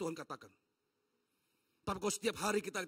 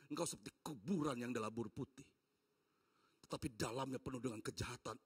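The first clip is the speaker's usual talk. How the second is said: deep, hushed voice of God rebuking; low stern rebuke; 9 semitones lower, 8 dB quieter than usual. Acted. Preacher voices God rebuking hypocrites, low and stern. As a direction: lower and colder, a stern divine warning.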